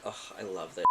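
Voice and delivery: mock inner thought. Voicing his secret thoughts.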